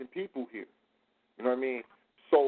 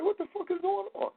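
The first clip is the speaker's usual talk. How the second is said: high-pitched disbelief; shocked reaction; 13 semitones higher, 4 dB quieter than usual. Retold or imitated. Watching the video, he blurts out in high shocked disbelief. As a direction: squeak it out in shock. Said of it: "I'm like".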